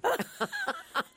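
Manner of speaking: short laugh, up high. Real, not acted. A guest laughing at the casting woman's dry remark, a high laugh. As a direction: laugh at the punchline.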